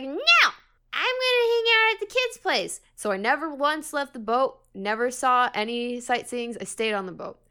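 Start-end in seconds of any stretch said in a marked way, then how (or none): 0.0-2.8 put-on whiny kid refusal. Child refusing parents, retold.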